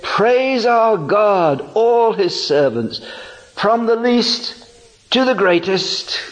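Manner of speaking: high, slow, booming proclamation; voice from the throne. A narrator's reading. Reading the throne's voice to the congregation, slow and booming. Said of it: "And the voice said".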